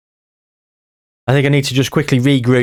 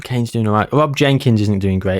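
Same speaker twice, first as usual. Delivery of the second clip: ordinary delivery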